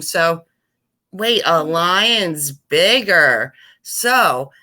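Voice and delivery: usual speaking voice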